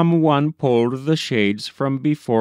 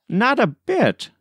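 much higher, cheerful denial; eager cry; 12 semitones higher than usual. A narrator's reading. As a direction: cry it out brightly to reassure. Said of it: "cried Doyle".

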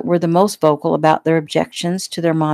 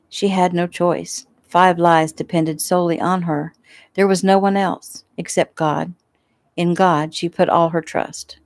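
usual speaking voice

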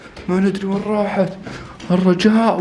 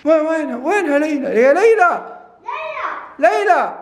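calling out; 10 semitones higher than usual. Pretend. Frantic search calling daughter.

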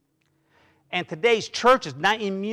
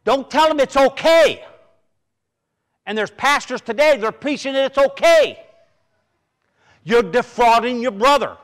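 higher, louder sermon voice; rising preacher's fervour; preaching at full force; 5 semitones higher, 4 dB louder than usual. Acted. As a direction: raise the voice to warn the church.

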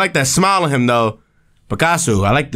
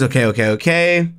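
normal talking voice

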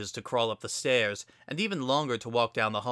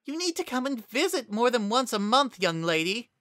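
put-on high, scolding old mare voice; scolding grandmother; 12 semitones higher than usual. A narrator's reading. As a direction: scold her like a fond grandma.

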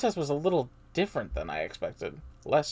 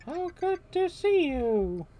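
high, slow princess voice; Princess Peach voice; 7 dB louder, much slower than usual. Acted. Player voices Peach welcoming Mario, high and slow. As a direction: lift it high and drawn out.

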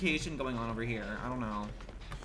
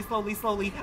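normal talking voice

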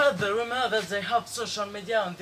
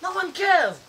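loud, high mocking echo; mocking shout; 11 semitones higher, 9 dB louder than usual. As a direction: throw the words back, loud. Mocking echo of sibling's doubt.

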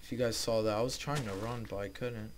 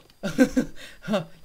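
high, loud burst of laughter; giddy nervous laughing; startled laugh; 8 semitones higher, 9 dB louder than usual. Real, not acted. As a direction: laugh it off in shock. After the disaster.

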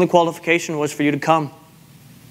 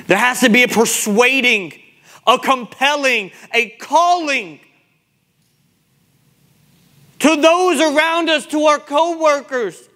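urgent, raised exhortation with long pauses; impassioned rebuke from the pulpit; full-force preaching; 7 semitones higher than usual. Real, not acted. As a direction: press the congregation hard, stretching key words.

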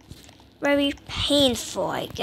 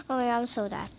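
normal talking voice

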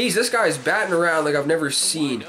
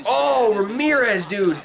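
normal talking voice

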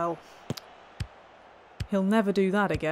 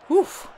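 loud, higher gasp into an oof; sharp gasp; 9 semitones higher, 6 dB louder than usual. Real, not acted. Manager watching a dangerous moment, sharp gasp. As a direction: suck in air at the near miss. Shocked by a bad tackle.

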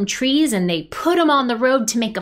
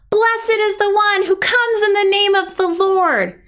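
raised, high-pitched proclamation; crowd shouting; 7 semitones higher, 4 dB louder than usual. Pretend. Storyteller as the crowd praising Jesus, shouting. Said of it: "Shout it with me".

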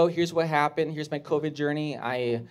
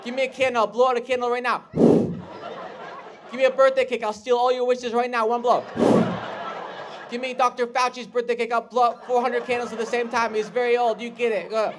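higher, louder mock tirade; hammed-up ranting; 9 semitones higher, 3 dB louder than usual. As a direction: keep the outraged anti-masker rant going. Rage bit continues.

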